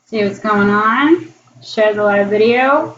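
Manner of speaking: ordinary delivery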